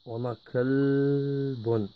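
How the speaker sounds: kalbun drawn out slowly for the class; teacher modelling kalbun at half speed; slow syllable-by-syllable demo. Shown as a demonstration. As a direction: stretch kalbun out so pupils catch each sound.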